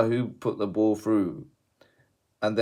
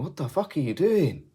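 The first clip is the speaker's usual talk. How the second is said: retold disbelief; 9 semitones higher than usual.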